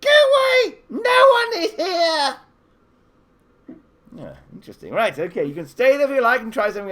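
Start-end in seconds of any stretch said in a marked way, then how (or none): 0.0-2.3 very high, loud squeaky little voice; a tiny creature shooing them off; squeaky hidden tree dweller telling them to go. Acted. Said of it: "you hear a tiny voice going".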